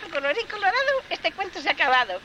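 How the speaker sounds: high, sing-song ending rhyme; storybook closing rhyme. Acted. Teller closing the tale with a playful high chant.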